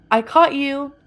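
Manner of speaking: loud, triumphant shout; triumphant yell. Retold or imitated. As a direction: call it out as if winning the game. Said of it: "I yelled".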